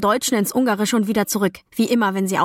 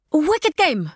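higher-pitched eager exclamation; excited call-out; 7 semitones higher than usual. A narrator's reading. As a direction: call out the answer, pitch jumping up. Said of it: "rief meine Mutter". (aufgeregter Ausruf)

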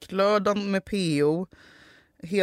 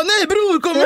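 loud, high pleading, much higher and louder; pushy pleading; 12 semitones higher, 6 dB louder than usual. Retold or imitated. Begging a friend to join the away trip, loud and high. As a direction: push the friends to come along.

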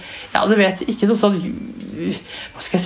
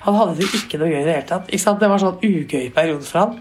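normal talking voice

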